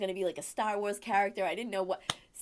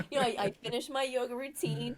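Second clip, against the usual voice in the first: ordinary talk, nothing marked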